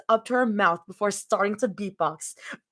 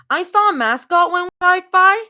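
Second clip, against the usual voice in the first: put-on raised, louder small child; little girl voice; 11 semitones higher, 9 dB louder than usual. A narrator's reading. Daughter explaining the mascot, eager child voice. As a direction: chirp it out like an eager kid.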